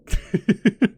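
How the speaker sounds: high, loud giggling cackle; loud staccato villain's giggle; gloating laugh. Acted. Gloating about the town hall.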